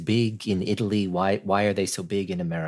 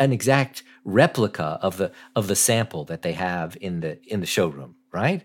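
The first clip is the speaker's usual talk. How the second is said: normal talking voice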